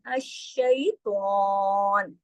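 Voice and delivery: slow, stretched recitation; slow Quranic recitation. Shown as a demonstration. Teacher reciting the verse for students, slow chant. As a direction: chant it slowly and evenly. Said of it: "बारीक तरीके से".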